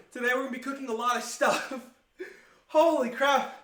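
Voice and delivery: fast, breaking into laughter; can't hold the laugh while talking; laughing through it. Real, not acted. Laughs mid-intro, out of breath.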